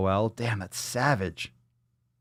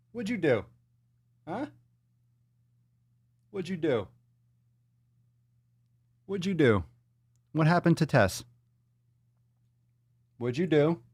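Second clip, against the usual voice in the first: high, soft mock-scold; mock scolding pet; 6 semitones higher, 3 dB quieter than usual. Real, not acted. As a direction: tease the cat about what it did.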